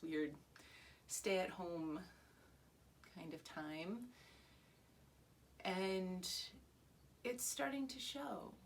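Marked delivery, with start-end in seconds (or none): none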